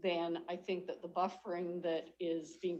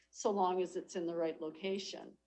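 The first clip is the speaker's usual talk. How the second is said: usual speaking voice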